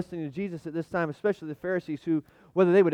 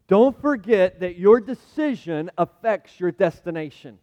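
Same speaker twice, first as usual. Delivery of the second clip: slow, louder warning to the church; solemn warning; 2 semitones higher, 8 dB louder, slower than usual. Real, not acted. Pastor sounds the first warning, slow and emphatic. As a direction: drive each word home slowly.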